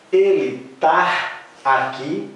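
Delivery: slow, rising "Ele tá aqui"; slow questioning demo. Shown as a demonstration. Teacher to class, slow doubtful example. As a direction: ask "Ele tá aqui" slowly, as if testing it. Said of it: "Vocês poderão falar".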